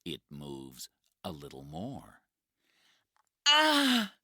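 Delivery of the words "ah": sharp high scream of pain; loud pained "ah"; high cry of pain. Acted. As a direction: cry out as the rock shifts. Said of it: "cries the woman".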